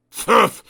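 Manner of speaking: loud dismissive pfft; grunt. Acted. Mister Grumpy grunting at the mystery, loud and cross. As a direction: brush it off crossly. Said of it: "grunted Mister Grumpy".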